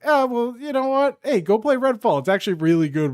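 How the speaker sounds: acted-out offhand announcement; mock company pitch. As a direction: casually talk up the game as Xbox. Mock Xbox spokesman quote.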